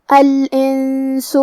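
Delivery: very slow الانس, long nasal hum before the s; ikhfa with ghunnah. Shown as a demonstration. Teacher showing the hidden noon, long hummed n in الانس. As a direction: draw out the hidden n of الانس through the nose. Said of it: "حکم کو غنہ کے ساتھ ادا کریں گے".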